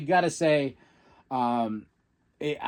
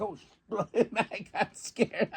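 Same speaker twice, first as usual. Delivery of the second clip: laughing; 6 semitones higher, 4 dB quieter, slower than usual. Retold or imitated. Laughing at pyro scare.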